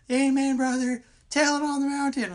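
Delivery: high, loud revival shout; shouting preacher. Retold or imitated. The other actor answering the preacher with a high, loud "Amen" shout. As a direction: belt it like a fired-up congregant. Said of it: "the other actor goes".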